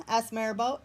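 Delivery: ordinary delivery, nothing marked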